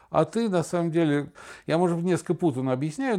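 normal talking voice

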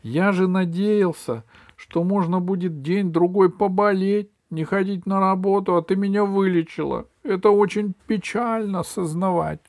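high, loud whiny complaint; lamenting king. Acted. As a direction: grieve over a lost sick day. Acted king laments being cured. (высокий жалобный голос короля)

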